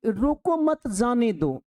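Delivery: group "रोको मत" as one phrase, then drop on "जाने दो"; pause after मत. Shown as a demonstration. Teacher reads a public sign to students, pausing after "मत". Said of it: "कि रोकना नहीं है, जाने देना उसको".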